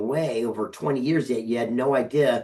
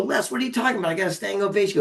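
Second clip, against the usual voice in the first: baffled protest; 10 semitones higher, much faster than usual. Retold or imitated.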